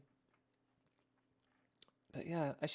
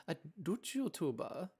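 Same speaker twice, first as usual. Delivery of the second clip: slow, attempted Dutch accent; botched Dutch accent; 4 semitones higher than usual. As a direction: badly put on a Dutch accent, drawn out. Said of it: "I don't know what the accent that was".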